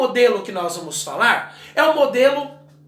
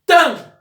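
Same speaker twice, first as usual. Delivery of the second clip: "Tan" said higher and louder; syllable called out; 5 semitones higher, 6 dB louder than usual. Shown as a demonstration. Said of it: "colocar o tracinho aqui".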